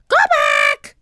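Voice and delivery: loud, screeching parrot voice; parrot squawk. A narrator's reading. Polly warning the pirates from above, squawking. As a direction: screech the words like a bird. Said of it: "squawked Polly".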